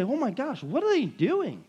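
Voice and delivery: high, excited onlooker, lightly voiced; amazed onlooker. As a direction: gasp at the crowd being baptized. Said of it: "drawing people in to say".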